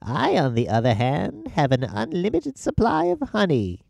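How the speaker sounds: high, gloating ant voice; smug little ant mocking the spider; taunting ant. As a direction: crow over the hungry spider.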